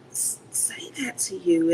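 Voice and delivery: trails off into a whisper. Retold or imitated. Retelling her reply to a soldier's mom, whispered. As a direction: breathe it, barely voiced.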